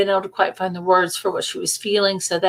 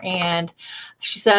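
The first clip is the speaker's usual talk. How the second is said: ordinary talk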